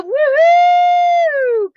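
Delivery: big high whooping cheer. Real, not acted. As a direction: whoop it out for the winner. Cheering winner Donna.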